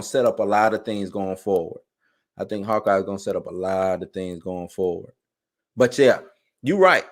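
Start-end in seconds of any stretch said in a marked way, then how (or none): 2.4-5.1 softer, as if to himself; quieter repeat. Shown as a demonstration. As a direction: let the repeat drop down softly. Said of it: "repeated line".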